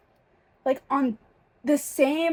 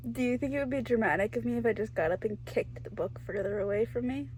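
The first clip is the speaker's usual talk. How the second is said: sheepish aside; 9 dB quieter, faster than usual. Real, not acted.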